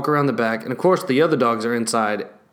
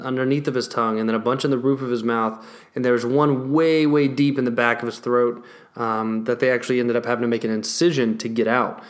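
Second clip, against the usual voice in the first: normal talking voice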